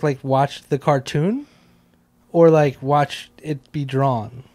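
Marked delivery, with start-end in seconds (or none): none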